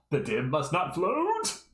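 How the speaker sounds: high, slow, shrieking alien invader; squeaky villain proclaiming loudly; Zim impression. Shown as a demonstration. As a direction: belt it out like a tiny alien tyrant. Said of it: "That's my best Zim impression".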